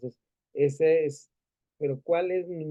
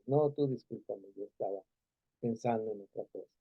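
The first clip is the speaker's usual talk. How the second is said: quiet, much softer reply; soft apology back; 4 semitones lower, 6 dB quieter than usual. Retold or imitated. Wife softly apologizing back to her husband, quiet. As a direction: answer the apology gently and low.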